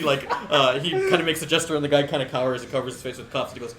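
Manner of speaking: normal talking voice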